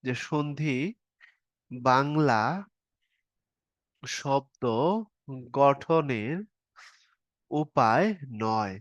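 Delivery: each word of যে সন্ধি বাংলা শব্দ গঠনের উপায় নয় set apart by long pauses; slow dictation. Shown as a demonstration. Teacher dictating a rule to students, slow and word by word. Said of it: "আমি আপনার এখানে আগে ভালো করে লিখে দিই".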